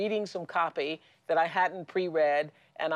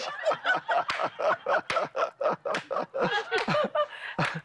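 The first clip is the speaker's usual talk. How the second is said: high laughter. Real, not acted. Laughing at the joke.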